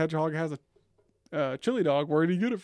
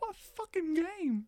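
high, slow, drawn-out mimicry; exaggerated baffled whine; mocking echo; 13 semitones higher, much slower than usual. Echoing the mocking imitation.

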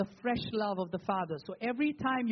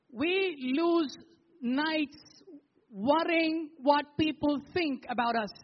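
impassioned preaching; 6 semitones higher, 3 dB louder than usual. Acted. Preacher rises to full force.

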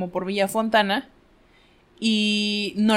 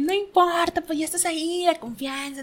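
higher, softer coaxing; coaxing dad; 7 semitones higher, 4 dB quieter than usual. Retold or imitated. Dad insisting she share a joke, higher and coaxing. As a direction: gently push her to tell one. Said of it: "Y el señor".

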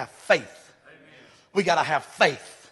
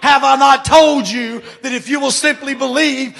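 proclaiming as Jesus; 6 semitones higher, 7 dB louder than usual. Acted. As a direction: declare it over the church. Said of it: "Jesus is saying that to the church".

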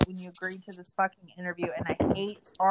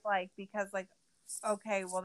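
usual speaking voice

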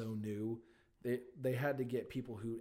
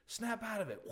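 high put-on old comedy voice; old-movie scolding; 10 semitones higher than usual. Mocking that era's way of calming someone, high scolding voice. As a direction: imitate a vintage slap-them-sane line, pitched up. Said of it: "that era of".

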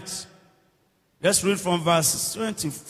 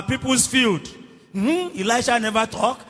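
ordinary talk

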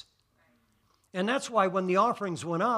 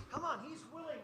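hushed prayer; 8 semitones higher, 14 dB quieter than usual. Real, not acted. Soft prayerful murmur after climax.

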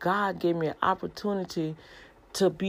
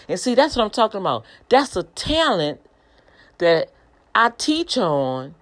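louder, fired-up preaching; exhorting the viewers at full force; 4 semitones higher, 6 dB louder than usual. Real, not acted. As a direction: preach it with rising fervor.

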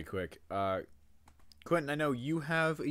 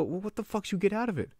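higher, quick quoted question; retold incredulous question; 9 semitones higher, faster than usual. Asking what they got out of it, retold incredulous. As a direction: ask it fast, pitched up in disbelief. Retelling an exchange with someone.

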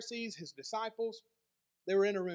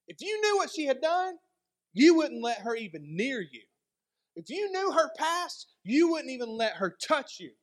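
higher and louder, scandalized protest; indignant accusers; 4 semitones higher, 6 dB louder than usual. Retold or imitated. Pharisees protesting to Jesus about the woman, loud and scandalized. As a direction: rise with disgust at each if. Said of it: "they go to Jesus".

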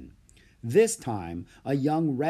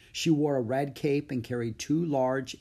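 ordinary delivery

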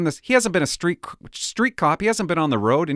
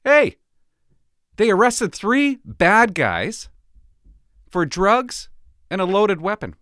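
higher, excited mock reporter; a light parody of an upbeat news anchor; mock news announcement; 5 semitones higher, 4 dB louder, slower than usual. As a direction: hype it like a cheery newscaster. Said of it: "CBC would come out and go".

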